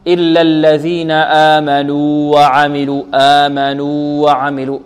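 slow intoned آمنوا with a long u before وعملوا; clear separation. Shown as a demonstration. Teacher applying the rule to the verse, slow separated recitation. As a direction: hold آمنوا, keep the w of وعملوا light. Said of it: "نظهرها".

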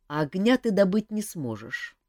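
usual speaking voice

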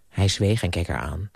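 ordinary delivery, nothing marked

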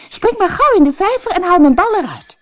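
put-on high and loud, bossy girl; eager princess. Princess sends the frog into the pond, high and eager.

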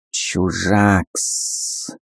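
soft hissing snake voice. Acted. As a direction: hiss it like a snake. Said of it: "зашипела змея".

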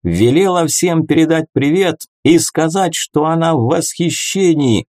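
high, carrying proclamation; yelled announcement. A narrator's reading. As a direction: announce it to a whole crowd. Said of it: "завопил я в ответ".

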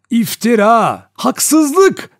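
high, loud, drawn-out protest; crying out. A narrator's reading. Vera protesting the accusations against her, crying out loudly. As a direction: cry out in outrage, pitch climbing. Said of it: "diye haykırdı Vera".

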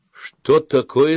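high, loud, slow question; indignant demand. A narrator's reading. Boy leader questions his silent friends, indignant. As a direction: demand it like a commander.

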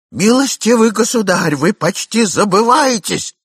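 high, loud, desperate outcry; desperate shout. A narrator's reading. Man in raccoon coat shouting at young man, desperate. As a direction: shout it in despair, pitch up. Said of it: "закричал господин в енотах в отчаянии".

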